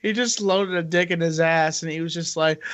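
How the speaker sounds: ordinary talk, nothing marked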